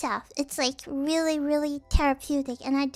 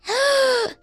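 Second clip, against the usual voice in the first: high gasp; 8 semitones higher, 4 dB louder than usual. Acted. Offended plushie.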